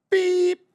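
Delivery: high loud bleep over a name; mock bleep. Guest telling the makeup story bleeps out the name.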